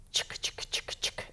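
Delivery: whispered rattling chick chick; rattling sound. A narrator's reading. The present rattling when Squirrel shakes it, whispered. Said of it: "it rattled".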